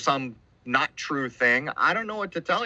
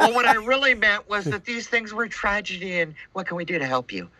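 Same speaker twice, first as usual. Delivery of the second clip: mock nervous backpedalling, pitch up; scared Mason; 6 semitones higher than usual. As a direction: play a frightened official squirming before the camera. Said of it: "a scared little thirty-second degree Mason".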